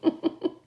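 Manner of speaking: short delighted laugh; a brief real chuckle. Real, not acted. Delighted by the card.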